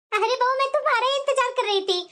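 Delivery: ordinary talk, nothing marked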